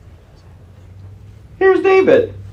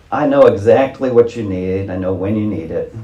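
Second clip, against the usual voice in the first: low, soft and calm, a lightly voiced God; gently promise, drifting quieter; reassuring God; 8 semitones lower, 5 dB quieter than usual. God reassuring the worried believer, softly, low and calm.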